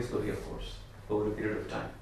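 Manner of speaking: ordinary talk, nothing marked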